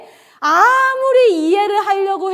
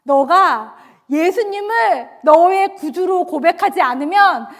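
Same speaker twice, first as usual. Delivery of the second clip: resolute, each phrase driven home; building to a loud, final refusal; firm ultimatum; 5 dB louder than usual. Retold or imitated. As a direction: lay down a firm condition. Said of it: "너무나 단호하게 저한테 이렇게".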